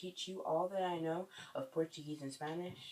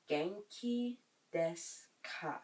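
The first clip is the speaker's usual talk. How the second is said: slow, separated "Genki desu ka?"; slow teaching repeat; slower than usual. Shown as a demonstration. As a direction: stretch it out piece by piece for learners. Teacher repeats phrase slowly for learners.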